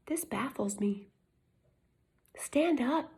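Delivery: hushed, emphatic whisper. Real, not acted. Vlogger urging viewers to stand up, whispered. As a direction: drop to a whisper for effect.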